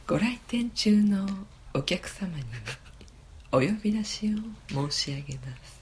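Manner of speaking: ultra polite singsong store announcement; lightly done polite PA announcer voice. Pretend. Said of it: "うちのウグイス嬢が読みますんで". (丁寧な店内アナウンス風の声)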